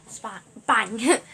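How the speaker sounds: sudden loud sound-effect words; loud crash imitation. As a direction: punch out the noise of the crash. Acting out the massive crash.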